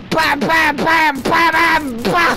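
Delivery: loud, punchy bangs; gleeful gunfire. Real, not acted. Gamer shooting teammates, loud gleeful gun noises. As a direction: fire off each word like a shot.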